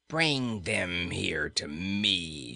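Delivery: slow, menacing cruel queen; savage queen. A narrator's reading. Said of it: "she cried savagely".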